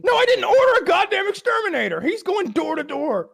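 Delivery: high, loud indignant outburst; exasperated. Real, not acted. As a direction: shoot down the teasing with shrieking indignation. Indignant answer to co-host's teasing question.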